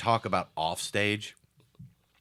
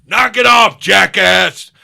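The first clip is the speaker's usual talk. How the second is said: loud, high angry yell at the jokers; furious shout; 11 semitones higher, 18 dB louder, slower than usual. Retold or imitated. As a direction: bark it at the two mockers. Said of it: "finally the guy turns around and goes".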